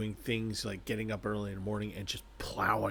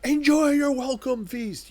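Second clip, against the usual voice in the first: very high, loud, slow gushing host; squealing delighted welcome; 12 dB louder, slower than usual. Retold or imitated. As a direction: welcome her like the happiest host alive. Host greets her, overjoyed.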